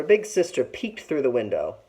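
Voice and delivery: usual speaking voice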